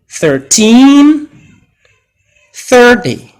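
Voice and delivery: put-on "Thirteen" on teen, "thirty" on thir, high and loud; model stress contrast. Shown as a demonstration. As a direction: say it for them to repeat. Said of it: "Repeat after me".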